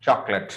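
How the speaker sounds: chocolate as "chok-lut"; correct pronunciation. Shown as a demonstration. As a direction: say chocolate short, two syllables. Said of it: "there is no late in".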